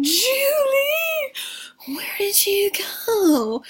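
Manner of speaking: high, drawn-out wail for someone lost; hammed-up calling out. Fiverr searching for lost Julie, hammed-up high calling. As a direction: call far for her in mock despair. Boyfriend calls for lost girlfriend.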